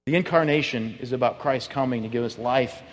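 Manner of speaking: ordinary delivery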